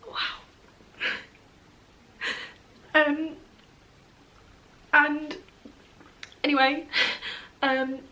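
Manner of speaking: pushing through the story while crying; real crying. Real, not acted. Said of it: "I'm a mess".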